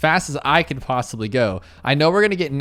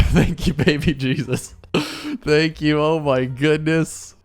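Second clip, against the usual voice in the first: laughing relief; much slower than usual. Real, not acted. Relieved, giddy after win.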